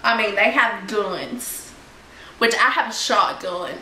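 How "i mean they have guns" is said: usual speaking voice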